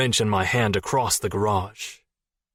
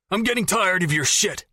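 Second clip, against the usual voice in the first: raised, much higher fed-up shout; angry yell; 10 semitones higher than usual. Acted. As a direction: let the frustration blow out. Jason yelling at Jess.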